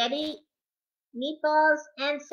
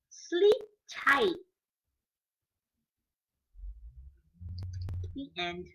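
bedtime story reading; 3 dB louder, slower than usual. Acted. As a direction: lull the kids toward sleep. Reading picture book to children.

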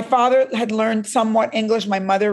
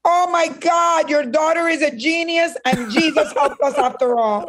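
a father overjoyed by the news; excited father; 6 semitones higher than usual. Retold or imitated. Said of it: "my father said".

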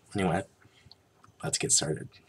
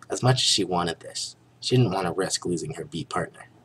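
plain reading voice

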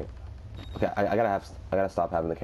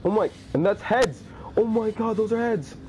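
horrified scream; 13 semitones higher than usual. Real, not acted. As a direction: scream at the severed heads. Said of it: "loudest scream I've ever done".

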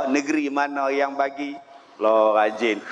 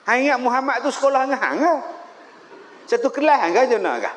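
mocking rebuke; 6 semitones higher, much faster than usual.

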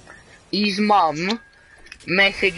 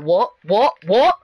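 retold challenge; 2 semitones higher, 4 dB louder, slower than usual. Boy re-enacting his challenge to Alfie, escalating. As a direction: step each "what" up, demanding a repeat. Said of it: "and I was like".

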